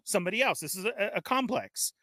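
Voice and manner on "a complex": ordinary talk, nothing marked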